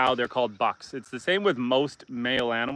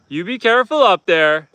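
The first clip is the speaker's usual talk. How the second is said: 1 calling out a warning; 6 semitones higher, 8 dB louder than usual. Real, not acted. Warning goat on propane tank.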